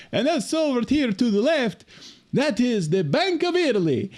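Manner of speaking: imitating the cabbie's high Italian accent. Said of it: "he goes".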